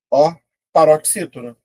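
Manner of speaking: usual speaking voice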